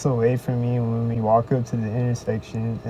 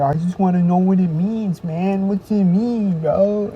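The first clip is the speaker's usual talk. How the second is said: higher and louder, pushy and needling; insistent mock stalker; 8 semitones higher, 5 dB louder than usual. Laughing stalker keeps pressing him about the sign, loud and pushy. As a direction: lean in hard, pressing for an answer.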